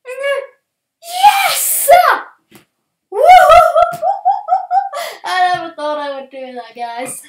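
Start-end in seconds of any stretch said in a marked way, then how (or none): 0.0-3.2 very high, very loud victory yell; triumphant shout. Real, not acted. The pig is finally penned, triumphant yell. 3.2-5.2 loud triumphant laugh. Real, not acted. Celebrating penning the pig.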